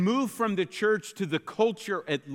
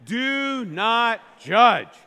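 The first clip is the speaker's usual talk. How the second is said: loud, slow, pointed "Do not judge"; hammed-up preachy scolding, much louder and slower; with attitude; 3 semitones higher, 6 dB louder, much slower than usual. Shown as a demonstration. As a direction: wag a finger and scold the room with it. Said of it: "Do it again".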